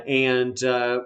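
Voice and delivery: ordinary talk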